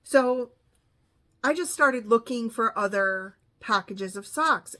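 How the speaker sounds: normal talking voice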